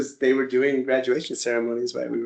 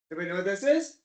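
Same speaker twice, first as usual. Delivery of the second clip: ordinary delivery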